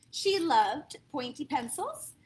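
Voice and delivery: usual speaking voice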